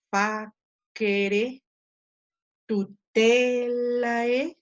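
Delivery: very slow Latin word list, each stressed syllable held long; stressed syllable drawn out. Shown as a demonstration. Teacher models reading Latin words by stressing them, drawn-out stressed syllables. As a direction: stretch the stress, as in "tutelae" with a long TE. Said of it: "acentuándola, y entonces leo".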